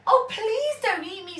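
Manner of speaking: high frightened plea; pleading rabbit. A narrator's reading. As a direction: beg for your life like a little rabbit. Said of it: "said the rabbit".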